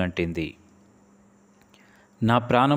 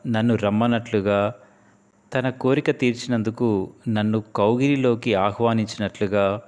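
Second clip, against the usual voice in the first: usual speaking voice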